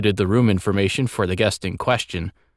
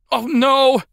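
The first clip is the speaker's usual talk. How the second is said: very high, loud wail of dismay; dismayed exclamation; 5 dB louder than usual. A narrator's reading. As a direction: cry out in sudden panic. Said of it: "he exclaimed".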